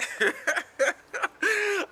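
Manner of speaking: high, helpless burst of laughter; real laugh. Real, not acted. Storyteller admits missing the flight, laughing hard. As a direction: laugh out loud at your own mishap.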